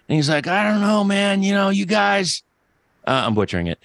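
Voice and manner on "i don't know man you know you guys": Terry Funk voice. Retold or imitated. Podcast host imitating wrestler Terry Funk for co-hosts, higher rasp. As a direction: do a rough Terry Funk impression. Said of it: "that Terry Funk voice".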